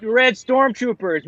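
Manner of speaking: ordinary talk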